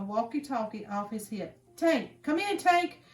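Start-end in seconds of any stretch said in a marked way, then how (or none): 1.8-3.0 higher, louder urgent call; urgent radio call. Acted. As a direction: bark into the walkie-talkie. Guard radios for backup.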